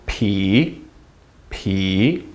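PI low, dipping, then rising; low dip-and-rise on PI; third tone. Shown as a demonstration. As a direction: let PI sink and climb back up. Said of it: "Third tone".

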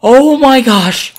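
high startled cry of fright; scared yelp. Real, not acted. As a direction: jump out of your skin and yelp. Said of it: "That was so scary".

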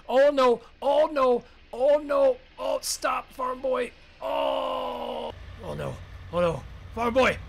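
loud, high panicked yells; panicked yelling. Acted. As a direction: scream for farm boy to get out. Truck crash, panic.

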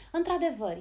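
high, loud burst of delight; bright high "Într-adevăr" cried out; excited exclamation. Acted. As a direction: exclaim it with joy. Quoted delighted exclamation opens line.

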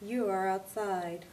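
a gentle woman speaking from above and behind; calm facility voice. Acted. The building's voice telling Mary where she is, calm and soft.

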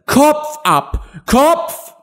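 high-pitched furious queen's cry; roaring queen. A narrator's reading. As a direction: bellow the order as an enraged queen. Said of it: "brüllte". (wütend brüllende Königin)

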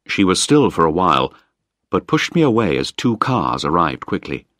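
usual speaking voice

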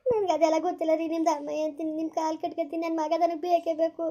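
crying, begging plea; tearful pleading. Acted. Wife begging husband to bring back their son. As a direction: beg him through tears.

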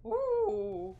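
high, loud excited ooh. Real, not acted. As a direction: squeal it out. Excited reaction.

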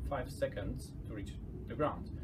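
usual speaking voice

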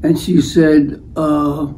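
usual speaking voice

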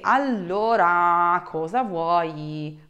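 slow, drawn-out, fed-up demand; irritated, exasperated. Shown as a demonstration. Teacher shows someone getting on her nerves, exasperated and slow. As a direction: drag each word out as if annoyed. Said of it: "you are just getting on my nerves".

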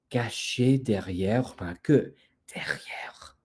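dropping into a whisper; hushed suspense. Acted. Storyteller asks children what hides behind the tail, hushed suspense. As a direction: whisper it like a secret being uncovered.

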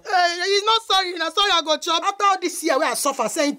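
mother crying down the phone at her son; tearful lament. Acted. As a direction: wail at your son that he's failing you.

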